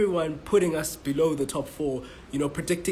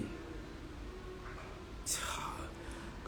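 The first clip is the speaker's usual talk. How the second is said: exasperated sigh; 15 dB quieter than usual. Real, not acted. Exasperated after the interruption.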